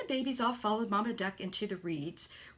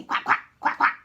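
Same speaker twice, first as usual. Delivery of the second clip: loud, unvoiced quack imitation; mother duck urging him to follow; 11 dB louder than usual.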